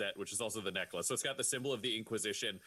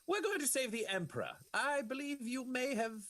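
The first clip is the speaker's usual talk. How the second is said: grand self-introduction with swooping pitch; lofty Inquisitor, rising high on key phrases; courteous Inquisitor; 9 semitones higher than usual. Acted. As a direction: introduce yourself with theatrical politeness. Plays Inquisitor introducing himself.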